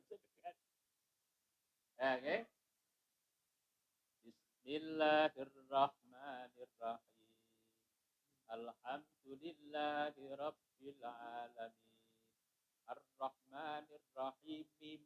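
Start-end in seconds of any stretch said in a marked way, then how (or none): 4.3-15.0 very soft, even chant; hushed, measured Fatihah recitation; Quran recitation. Shown as a demonstration. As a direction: recite quietly and steadily with long vowels.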